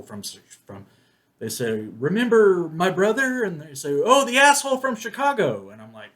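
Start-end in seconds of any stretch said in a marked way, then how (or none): 2.0-3.4 raised, louder mock question; quoted movie character. Voicing sister's scenes in the film. 4.0-5.7 high, loud mock recognition; quoted movie character. Voicing a reply from the film.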